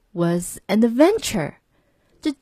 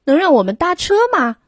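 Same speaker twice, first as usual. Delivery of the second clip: higher, louder and quick plea; hopeful sailors; 8 semitones higher, 4 dB louder, faster than usual. A narrator's reading. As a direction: rush the hopeful question. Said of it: "船员们问".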